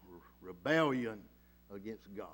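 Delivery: ordinary delivery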